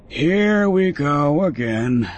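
put-on slow, scoffing gravelly old farmer; gravelly old man. A narrator's reading. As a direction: sneer it out like a grumpy old man. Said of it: "derision evident in his gravelly voice".

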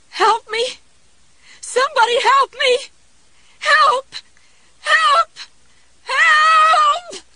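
high shrieking cries for help; panicked screaming. A narrator's reading. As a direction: scream until the voice breaks. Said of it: "Her voice broke at last".

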